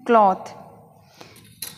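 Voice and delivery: क्लॉथ with an open AW; correct pronunciation. Shown as a demonstration. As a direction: say क्लॉथ as "klawth". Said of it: "ये ओ के लिए है sign".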